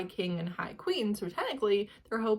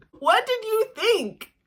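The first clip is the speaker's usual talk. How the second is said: higher, louder, slow incredulous; incredulous rhetorical question; exasperated; 11 semitones higher, 6 dB louder than usual. Real, not acted. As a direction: scold the character. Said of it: "exasperated rhetorical".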